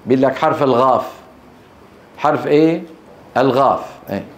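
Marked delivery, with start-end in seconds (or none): 0.4-1.1 الغاف, the letter ق said as gh; Sudanese Qaf. Shown as a demonstration. Teacher imitating Sudanese speakers, ق turned to gh. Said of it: "القاف ينطقونها إيه؟". 3.4-3.8 الغاف, the letter ق said as gh; Sudanese Qaf. Shown as a demonstration. Teacher imitating Sudanese speakers, ق turned to gh. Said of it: "غين".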